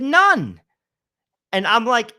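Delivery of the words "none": sharp, high, loud "None."; a classmate admitting defeat in frustration, high and loud; frustrated snap. Retold or imitated. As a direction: bark it out in exasperation. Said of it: "in frustration in the conversation".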